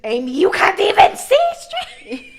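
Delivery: high, loud, slow incredulous cry, laughing through it; exasperated ma. Retold or imitated. Ma turning on the light and seeing her drunk daughter, loud disbelieving cry. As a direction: shout it in disbelief.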